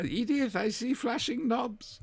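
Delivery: put-on high, drawn-out quavering old-lady cry; mock high-pitched sitcom wail; imitated catchphrase.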